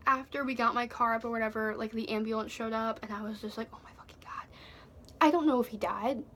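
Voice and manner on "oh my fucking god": hushed, breath-only exclamation; stunned whisper. Retold or imitated. Watching the ambulance show up, stunned whisper. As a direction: whisper it in disbelief.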